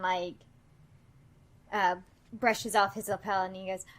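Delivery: ordinary talk, nothing marked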